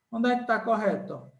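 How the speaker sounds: ordinary talk, nothing marked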